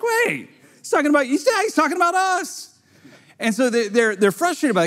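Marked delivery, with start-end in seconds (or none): 0.0-2.8 high, squeaky voice as the leaders catch on; high comic realization among the leaders; mock dawning panic. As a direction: go way up as it dawns on them. Leaders realize it's all of them.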